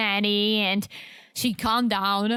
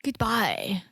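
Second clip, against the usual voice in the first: breathy whisper; whispered goodbye; 2 semitones lower than usual. Pretend. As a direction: whisper it like a tease. Mock farewell to Mimi and Wish.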